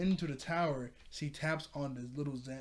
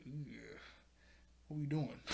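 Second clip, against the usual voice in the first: mock-weary, much softer aside; retold reluctant groan; 8 dB quieter, slower than usual. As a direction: imitate two grudging dads under your breath. Dads react to Sissy's threat, muttered.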